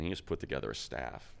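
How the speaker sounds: ordinary delivery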